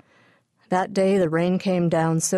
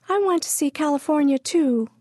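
put-on much higher toddler voice; whiny little sister; 9 semitones higher than usual. A narrator's reading. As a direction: demand it like a small child. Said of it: "Pidge said".

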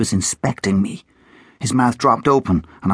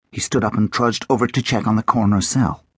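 ordinary delivery

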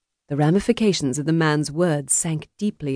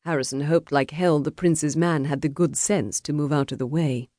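usual speaking voice

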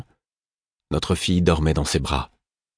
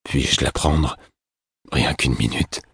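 lightly voiced pleading father figure; pleading man; 4 semitones lower, slower than usual. A narrator's reading. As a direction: plead gently for a moment. Burick pleads for the baby.

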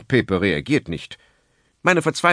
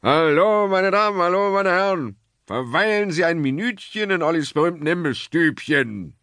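higher, louder hawker calling to passers-by; a booming market crier drumming up customers; loud snack-bar barker; 6 semitones higher than usual. A narrator's reading. Snack-bar owner calls out to customers, loud hawker. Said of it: "schreit jetzt jemand so laut".

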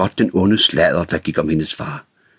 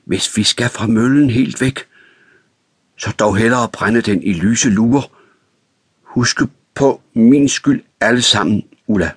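words forced out; 6 semitones higher than usual. A narrator's reading. Frank forcing out his confession to Ulla, strained. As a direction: force each phrase out in distress. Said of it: "Han stødte ordene frem".

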